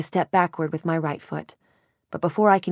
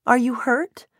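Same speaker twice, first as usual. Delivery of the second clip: high, anxious question; alarmed, raised pitch; worried mother; 8 semitones higher, slower than usual. A narrator's reading. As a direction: urgently check for injury. Alarmed mother finds fallen daughter.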